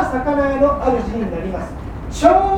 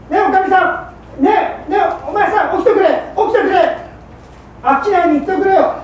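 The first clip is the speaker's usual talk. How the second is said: higher, insistent wife's voice; higher wife pleading to wake up; wife waking husband; 8 semitones higher, 5 dB louder than usual. Acted. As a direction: coax him awake, urgent.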